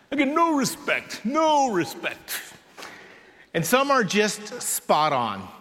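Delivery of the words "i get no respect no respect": lightly voiced comedian's catchphrase, higher than usual; a hint of a comic's higher-pitched complaint; Rodney Dangerfield. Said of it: "like this one from comedian Rodney Dangerfield".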